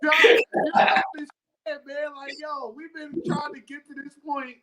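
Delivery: crying. Real, not acted. Fan tells John of eight years waiting, crying. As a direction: choke up with happy tears, apologizing through it. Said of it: "I'm crying".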